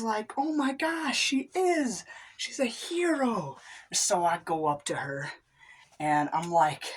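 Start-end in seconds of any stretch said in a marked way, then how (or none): 0.4-3.6 high, breathless starstruck gush; high awed gush over the athlete. Acted. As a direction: gasp it out, starstruck. Margaret Sanger gushing.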